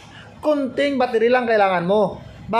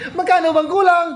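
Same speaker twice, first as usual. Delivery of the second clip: lightly voiced eager battery, pitched higher; a helpful battery jumping in to ask; battery's voice; 6 semitones higher than usual. Battery offers to cover the solar shortfall, light mock higher voice. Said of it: "Sasabihin naman ni battery".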